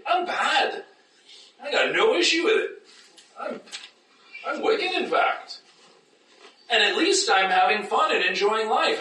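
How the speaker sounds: high, laughing, cheeky confession; hammed-up proud sinner; mock carefree boast. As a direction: brag about being wicked for fun. Imitating a carefree unbeliever.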